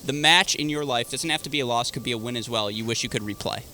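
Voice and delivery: ordinary talk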